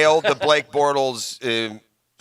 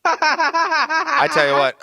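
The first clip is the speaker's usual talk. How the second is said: laughing; 4 dB louder, much slower than usual. Real, not acted. Laughing at shrimp joke.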